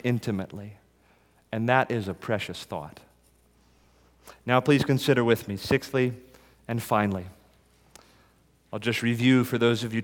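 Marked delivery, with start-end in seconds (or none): none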